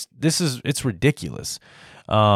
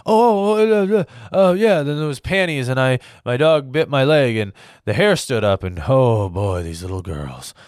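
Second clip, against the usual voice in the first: hammed-up mumbling speech that loses its thread; Joe Biden. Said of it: "That is my impression of, uh, of Joe Biden".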